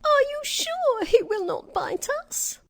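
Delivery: very high, timid little mouse; timid squeak. A narrator's reading. Mouse queen fears the dog will bite, timid squeak. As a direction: ask nervously in a tiny squeak. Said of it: "in a timid voice".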